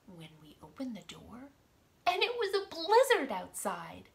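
lively storybook read-aloud; reading to children. Acted. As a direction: read it out to little kids. Reads picture book to children.

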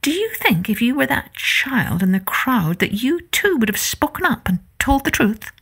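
ordinary delivery

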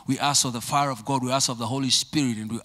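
usual speaking voice

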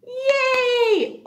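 high, loud yay; cheer. Real, not acted. Teacher cheers after the class poem, high.

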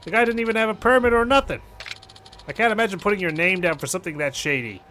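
lightly voiced, exasperated caller; a hint of an annoyed young boss; indignant club manager. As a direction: gripe about the scam. Voices Yuya complaining of the scam.

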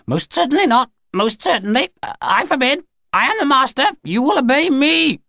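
very high, imperious alien voice; commanding Grinny. A narrator's reading. Grinny commands her punishment machine to obey, high and imperious. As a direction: forbid it at the top of your pitch.

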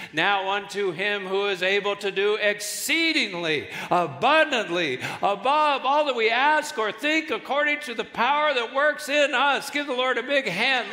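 loud, high, rousing sermon delivery; full-force preaching. Real, not acted. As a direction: proclaim it to stir the congregation.

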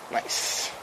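soft whisper of approval; whispered nice. Real, not acted. Cook whispering praise to himself after a clean cut. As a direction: breathe the word out.

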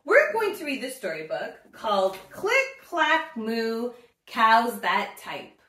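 put-on bright, cheerful intro to kids; upbeat children's host; story time host. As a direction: invite the little ones to read along.

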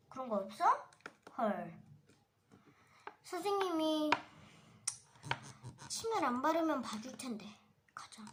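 usual speaking voice

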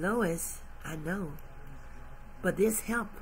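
hushed divine comfort; God's voice. Acted. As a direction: answer her quietly as a loving God. God answering Lois softly.

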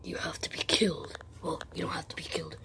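usual speaking voice

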